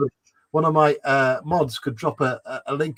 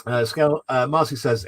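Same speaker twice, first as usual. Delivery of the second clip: normal talking voice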